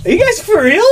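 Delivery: can't believe what they just said; incredulous. Retold or imitated. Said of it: "And I'm like".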